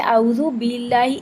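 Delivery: باللہ with a slack, airy ب close to پ, slow; loose b, wrong. Shown as a demonstration. Teacher imitating students' common mistake, slowly. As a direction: say اعوذ باللہ lazily so ب leaks air. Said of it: "ڈھیلے انداز سے پا کو ادا".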